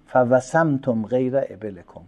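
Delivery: ordinary talk, nothing marked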